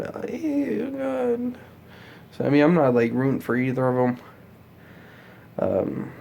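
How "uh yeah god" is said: high, sarcastic "uh yeah, God"; mock groan. Vlogger mocking a disappointed voter with a high, sarcastic groan. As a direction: roll your eyes and say it pitched up.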